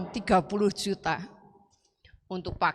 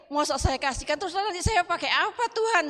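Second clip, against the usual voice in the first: high-pitched incredulous complaint; acted-out protest to God; dismayed plea; 8 semitones higher than usual. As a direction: plead upward in disbelief, climbing higher. Retelling her dismayed plea to God.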